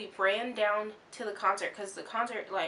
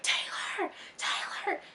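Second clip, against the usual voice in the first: retold yell; 10 semitones higher, slower than usual. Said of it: "I was yelling at my friend".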